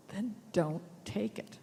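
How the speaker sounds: ordinary delivery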